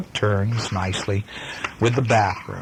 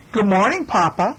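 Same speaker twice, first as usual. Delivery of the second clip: higher, sugary greeting; be as sweet as pie; sweetly nice; 9 semitones higher than usual. Acted. Sister greeting Papa sweetly to show off. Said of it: "as nice as she could be".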